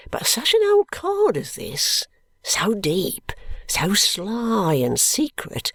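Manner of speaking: hushed, conspiratorial whisper; secretive whisper. Acted. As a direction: whisper it like gossip about a sly old man. Guppy gossips about sly old Krook.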